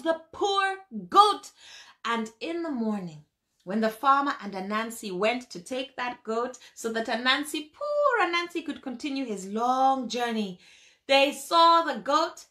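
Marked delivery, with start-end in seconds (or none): none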